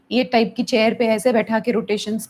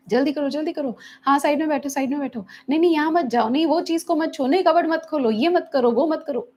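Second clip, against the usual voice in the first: high, hurried nagging orders; stressful commands; 6 semitones higher than usual. Shown as a demonstration. Therapist shows parents a stressed-out way of commanding a child, high and hurried. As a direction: rattle off don'ts to stress the listener. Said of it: "क्या stressed out feel हो रहा है?".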